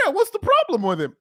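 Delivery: high imitation of the wife; high mock wife voice; mimicking wife. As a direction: lift the voice way up, innocent question. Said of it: "She was like".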